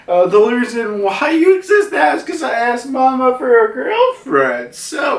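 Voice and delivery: high-pitched cartoon boasting; bragging cartoon homie. Prometheus explains to Hera her origin, bragging. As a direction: brag in a high put-on voice.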